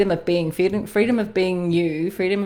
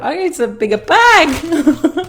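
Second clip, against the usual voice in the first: laughing; 11 semitones higher, 9 dB louder, slower than usual. Real, not acted. Laughing after energy release.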